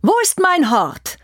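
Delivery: high, fast indignant queen; furious demand. Acted. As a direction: claim what is yours. Queen demands her treasure.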